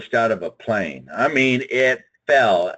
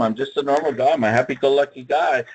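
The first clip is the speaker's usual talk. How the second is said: normal talking voice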